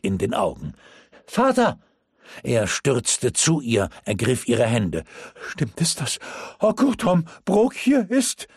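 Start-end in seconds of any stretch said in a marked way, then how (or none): 1.3-1.8 high, loud cry to her father; calling out. Acted. Almut calls out to her father. 5.5-8.5 panicked question. Acted. Panicked father asks urgently.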